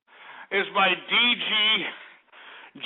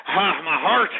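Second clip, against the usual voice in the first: usual speaking voice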